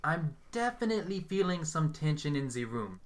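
a hint of a theatrical medium; Hazel's psychic voice. A narrator's reading. Young medium greeting the group in her put-on voice.

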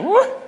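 high, loud "woo"; excited whoop. Retold or imitated. Fan reacts to a favorite movie scene, high excited whoop. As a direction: whoop with a sudden shiver of excitement.

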